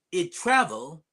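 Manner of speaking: usual speaking voice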